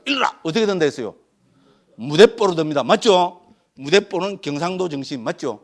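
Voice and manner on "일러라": high, sudden command; Zen master's demand. Acted. Master pressing a student on the hwadu, high-pitched demand. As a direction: throw out the demand high and abrupt.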